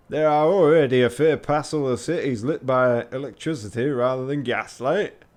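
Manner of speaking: loud, hammed-up Yorkshire retort; thick Yorkshire accent. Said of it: "had to ask a translator".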